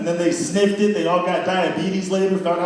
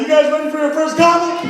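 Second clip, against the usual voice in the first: higher, louder crowd hype; hype call; 8 semitones higher, 4 dB louder than usual. Real, not acted. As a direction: pump up the audience. Host hyping the crowd.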